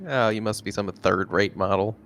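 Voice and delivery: ordinary talk, nothing marked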